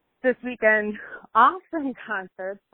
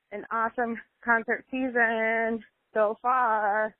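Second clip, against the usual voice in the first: ordinary talk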